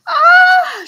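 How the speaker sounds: high, loud shriek of fright; terrified scream. Acted. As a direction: cry out as the hand grabs her. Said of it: "she cried".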